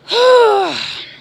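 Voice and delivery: high, loud groaning sigh; fed-up sigh after the rant on hackers; loud exasperated sigh. Real, not acted. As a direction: blow out all the frustration. Ranting at hackers.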